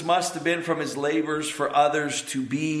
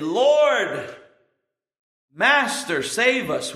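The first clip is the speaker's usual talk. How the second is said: higher, louder cry for rescue; desperate plea; 6 semitones higher, 3 dB louder, much slower than usual. Acted. Disciples in the sinking boat begging Jesus, desperate cry. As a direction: cry out to be saved as the boat fills.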